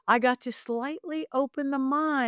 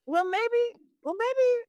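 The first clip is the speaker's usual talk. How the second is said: much higher, unsure maybe; high wavering hesitation; 13 semitones higher, slower than usual. Retold or imitated. Psychic talking herself into the gig, high and unsure. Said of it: "but I'm like".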